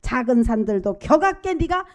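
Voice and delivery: loud, rising climax of the sermon; fervent preaching. Acted. Preacher to congregation about God's strength, fervent loud preaching. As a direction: preach it out with full force.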